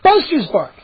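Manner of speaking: usual speaking voice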